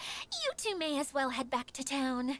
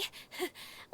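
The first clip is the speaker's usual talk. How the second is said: breathy little giggle; a bashful airy chuckle; soft laugh; 8 dB quieter than usual. Acted. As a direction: laugh it off shyly. Shy giggle after teasing.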